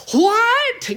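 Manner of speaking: high, loud, outraged What; emphatic What. Shown as a demonstration. Paul rejecting the Corinthians' conduct, a high loud emphatic What. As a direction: fling it out as a shocked objection. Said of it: "emphatically say it in the Greek text".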